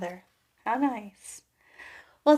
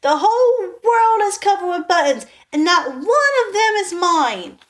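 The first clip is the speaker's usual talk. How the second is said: high, loud furious outburst; angry scream; 8 semitones higher, 8 dB louder than usual. A narrator's reading. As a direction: Toad stamps and screams at the world. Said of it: "jumped up and down and screamed".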